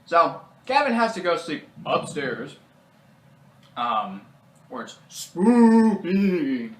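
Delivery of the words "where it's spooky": high, loud, very drawn-out ghostly word; mock spooky. Telling of sleeping upstairs, mock-spooky voice. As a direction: stretch it out like a playful ghost.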